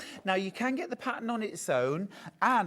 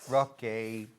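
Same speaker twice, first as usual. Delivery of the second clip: usual speaking voice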